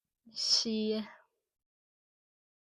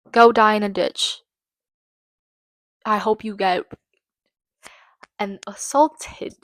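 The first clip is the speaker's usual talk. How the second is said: angry outburst; 5 dB louder than usual. Real, not acted. Furious rant at the convicted killer.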